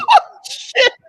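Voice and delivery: sudden piercing cackle; loud high laugh. Real, not acted. Co-host cracks up at the barbecue rant, loud high laugh.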